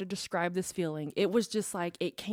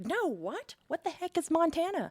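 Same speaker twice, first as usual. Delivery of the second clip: baffled; 9 semitones higher than usual. Retold or imitated. Baffled at the voice's command.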